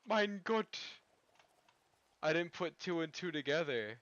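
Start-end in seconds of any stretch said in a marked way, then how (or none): 0.1-0.8 mock German. German exclamation after surprise.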